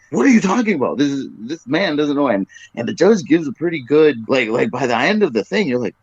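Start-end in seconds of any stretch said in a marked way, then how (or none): 0.1-2.3 higher, faster flustered denial; flustered protest. Retold or imitated. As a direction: voice the panicked preacher rising in pitch. Preacher protesting, higher retake.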